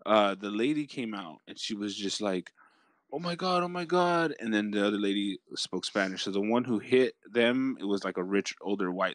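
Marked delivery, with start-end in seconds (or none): 3.1-4.3 high-pitched mock dismay; retold panic. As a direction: imitate a flustered crash victim. Retelling distressed lady from crash.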